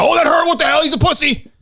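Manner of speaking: mocking whiny critic. Host mocking critics who call him sensitive, sneering high whine. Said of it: "it's like".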